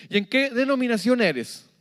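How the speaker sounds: high, loud pointed question; pry at once about the church; a stranger at the door quizzing you; nosy visitor. Retold or imitated. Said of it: "Primera pregunta que te dan".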